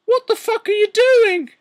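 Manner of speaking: very high, loud put-on screech; shocked mock shriek. Teacher catching two students in the act, mock shriek. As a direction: screech it in an over-the-top falsetto.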